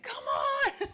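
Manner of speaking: high, loud mock cheer; cheering shout. Sisters cheering so hard at the TV, cheering shout. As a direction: yell it like cheering a racer.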